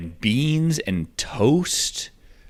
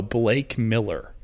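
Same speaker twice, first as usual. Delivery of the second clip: ordinary talk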